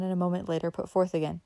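plain reading voice

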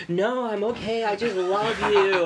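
mock a starstruck girl gushing through laughter; gushing fan voice. Fan on the balcony answering the singer, high gushing. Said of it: "she's just like".

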